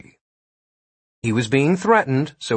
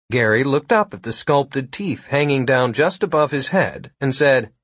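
ordinary delivery, nothing marked

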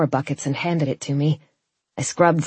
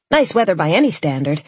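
usual speaking voice

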